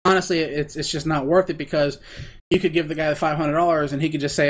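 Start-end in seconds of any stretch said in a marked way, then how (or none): none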